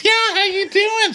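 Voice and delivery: very high mock small talk; exaggerated high-pitched friendliness; high-pitched imitation. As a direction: keep chirping way up high, parodying her. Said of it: "She gets that really high-pitched voice".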